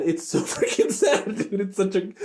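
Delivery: high, laughing lament; real laugh, mock despair. Real, not acted. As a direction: laugh while calling it sad. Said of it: "it's so sad".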